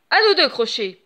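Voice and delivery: ordinary delivery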